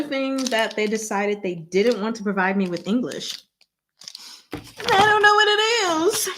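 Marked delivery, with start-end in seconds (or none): 4.5-6.3 whiny. Pretend. Baffled by unlabeled package.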